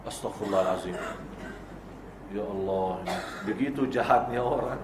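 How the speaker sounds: soft, low dismayed sigh of prayer. Real, not acted. Preacher lamenting a father's cruel threat, softly dismayed. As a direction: murmur it in quiet dismay.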